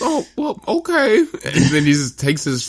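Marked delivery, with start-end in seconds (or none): 0.0-1.6 high falsetto, sheepish giving-in; imitated high kid voice; mock meek son. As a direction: squeak out a reluctant agreement. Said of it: "And he goes".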